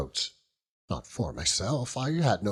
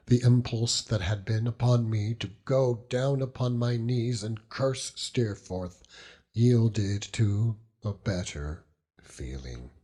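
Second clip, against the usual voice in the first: plain reading voice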